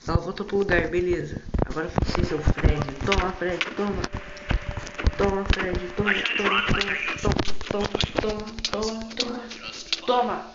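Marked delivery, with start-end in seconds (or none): 1.7-10.5 loud, high frantic shouting; panicking gamer. Real, not acted. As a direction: hammer out each hit yelling. Attacking Fred, shouting. (gamer em pânico gritando)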